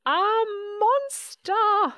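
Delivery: high, loud, drawn-out alarm; screamed in fear. A narrator's reading. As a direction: cry out in terror, stretching each word. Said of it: "screamed Baby".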